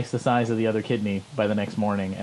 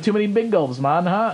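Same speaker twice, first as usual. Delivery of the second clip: higher mock Jamaican accent; toned-down imitation of a Jamaican man; lightly hammed Jamaican lilt; 8 semitones higher, 3 dB louder than usual. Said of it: "it's this Jamaican guy".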